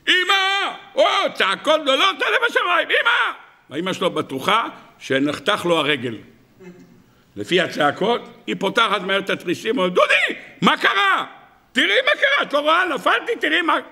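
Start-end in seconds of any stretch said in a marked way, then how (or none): 0.1-3.8 put-on very high, wailing child's shout; hammed-up cry, as if badly hurt; screaming for Mom. Boy yells to his mother before reaching home, hammed-up screaming. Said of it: "הוא מתחיל לצעוק". 9.9-11.2 high, drawn-out panicked call; acted motherly panic, calling out; alarmed mother. Mother calls to son from the shutters, acted alarm. 11.7-13.8 very high, hammed-up wailing; screaming boy. Boy shows his mother what happened, hammed-up screaming. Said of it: "אתה צורח".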